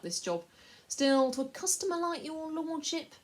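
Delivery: butter up the buyer; wheedling Pug. A narrator's reading. Slaver selling the boy to the lord, fawning.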